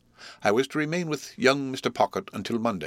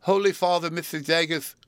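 higher, louder alarmed cry; frightened cry; 9 semitones higher, 3 dB louder than usual. Acted.

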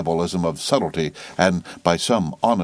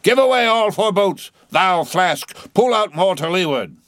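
high, loud commands to the boats; shouted orders; 10 semitones higher, 4 dB louder than usual. A narrator's reading. Captain directs Flask's boat, shouting. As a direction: bark the orders across the water.

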